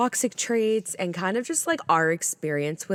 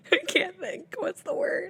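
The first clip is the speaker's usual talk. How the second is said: laughing. Real, not acted. Helpless laughter, can't find word.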